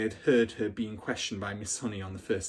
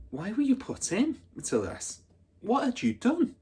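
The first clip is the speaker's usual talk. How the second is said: put-on much higher child voice; little girl's question; 13 semitones higher than usual. Matilda asking the big girl, much higher. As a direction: ask small and curious.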